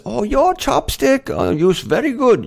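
fond Korean-accented impression of the guest; imitating Korean accent. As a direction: mimic the Korean girl praising him in her accent. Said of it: "she says".

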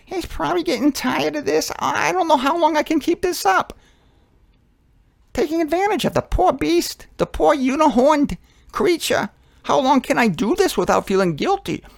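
high-pitched fretful bird voice; squeaky-high worried tick bird. Acted. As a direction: fret up high as a guilty little bird. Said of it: "And the tick bird says".

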